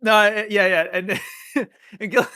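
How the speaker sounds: normal talking voice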